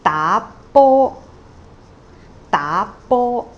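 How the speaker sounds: da rising, bo high and level, said loud; rising then high tone. Shown as a demonstration. Teacher models da bo, rising then high. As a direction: rise on da, hold bo high. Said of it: "two and one".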